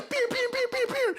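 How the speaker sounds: squeaky high chicken squawk for a playful pew-pew chant; silly sky-high chicken voice with a laugh in it; crazy chicken voice. Retold or imitated. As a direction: go way up high like a frantic cartoon chicken. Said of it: "like crazy chicken".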